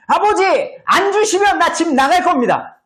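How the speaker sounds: high, insistent son's threat; forceful demand. Acted. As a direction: demand it hard from your father. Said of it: "아주 강력하게 아버지에게 돈을 요청한 거죠".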